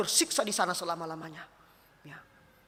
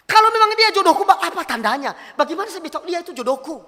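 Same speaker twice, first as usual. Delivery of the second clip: high, loud, pleading question; questioning young person; 10 semitones higher, 7 dB louder than usual. Retold or imitated. Young person asking the pastor about a soulmate, acted insistent plea. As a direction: act out an insistent young asker. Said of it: "anak muda bertanya kepada saya".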